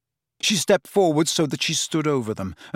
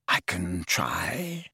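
1 slow low reply; 7 semitones lower, 7 dB quieter, much slower than usual. A narrator's reading. Snape's terse answer.

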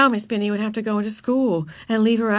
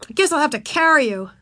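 put-on higher, mildly put-upon boy; grudging; 8 semitones higher, 4 dB louder than usual. A narrator's reading. Boy agreeing to carry the girl, grudging. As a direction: give in reluctantly. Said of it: "his tone was grudging".

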